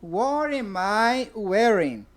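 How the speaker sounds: "What am I wearing?" said slowly, word by word; slow model question. Shown as a demonstration. Teacher to class, slowly modelling the first question. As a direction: spell out the question slowly for learners. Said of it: "Eu vou dizer assim".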